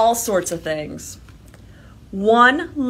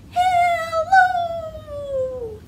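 very high squeaky hello; squeaky mouse voice. Shown as a demonstration. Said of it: "you need a squeaky mouse voice".

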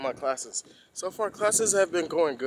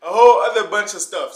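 loud excited; 7 semitones higher, 16 dB louder than usual. Real, not acted. Excited outburst after laughing.

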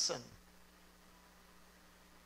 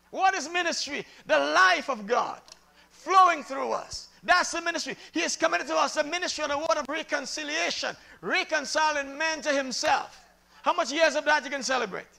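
loud, deliberate pulpit phrases; fervent sermon cadence; emphatic preaching; 5 semitones higher, 7 dB louder than usual. Real, not acted.

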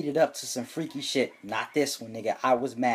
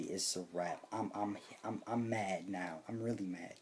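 much softer, lower simmering anger; quiet seething; 6 semitones lower, 15 dB quieter than usual. Real, not acted. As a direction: hold the rage in under the breath. Narrator seething after strike three.

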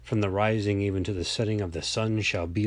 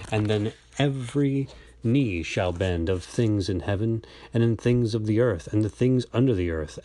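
ordinary delivery

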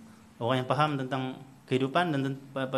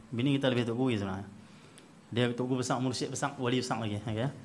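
ordinary talk

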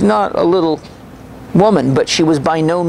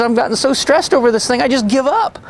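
imitating her higher, upset and on the verge of tears; tearful frustration; 9 semitones higher than usual. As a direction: voice her despair, pitched up and tearful. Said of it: "so she started crying".

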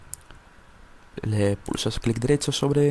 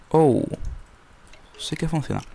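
ordinary delivery

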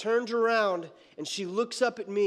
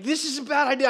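shaky fear; 6 semitones higher, 5 dB louder, faster than usual. Retold or imitated. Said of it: "like, shaking".

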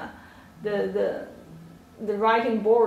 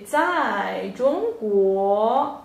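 slow teaching demo; 6 dB louder, much slower than usual. Shown as a demonstration. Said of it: "teacher modelling the phrase".

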